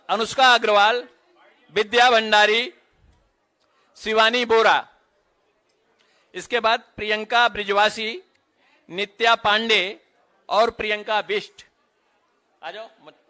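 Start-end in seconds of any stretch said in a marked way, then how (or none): 0.1-11.6 projected ceremony announcement, name by name; roll-call announcer. Real, not acted. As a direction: call names out firmly to a crowd.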